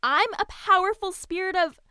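loud offended protest; indignant shout. Acted. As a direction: shout it, insulted.

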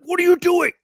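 high, loud outburst of mock alarm; imitated panicked yell. Imitating a friend guarding his fridge, mock panicked yell. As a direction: yell it like someone caught meddling.

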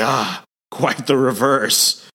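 slow, creepy monster voice; drawn-out menace from the undead beast; revived demonic Walter answering the doctors, in a slow creepy voice. Retold or imitated. Demonic Sasquatch's creepy line.

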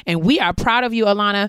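a proud shout-out to the graduate; proud cheer. Real, not acted. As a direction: cheer her on loudly.

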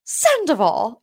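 sudden surprised yelp; mock shock. Recapper imitating Lisa's surprised reaction to the news. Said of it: "You're like".

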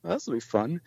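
hushed, amused aside; chuckling to himself. Retold or imitated. Barista amused at the coming fanboy moment, quiet aside to himself. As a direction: say it softly, as if to yourself. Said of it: "he's just laughing to himself".

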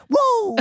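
high, playful woo; excited whoop. Real, not acted. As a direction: let out a quick whoop. Cheering after the joke.

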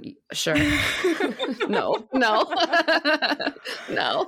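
breaks into high laughter between a few words; laughing it off. Real, not acted. Co-host laughing off a rumor about her favorite player. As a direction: laugh through a disbelieving no, no.

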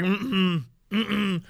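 normal talking voice

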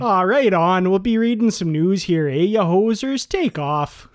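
loud, hammed-up Canadian accent; full Canadian accent. Shown as a demonstration. Co-host showing off full Canadian on request, exaggerated Canadian accent. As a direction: lay on a comic Canadian accent, projected. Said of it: "I could go full Canadian".

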